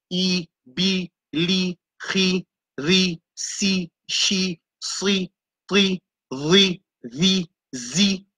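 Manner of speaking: each syllable spelled out clearly; modelled sounds. Shown as a demonstration. Teacher sounding out Arabic letters for the class, clipped and clear. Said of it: "c'est le son".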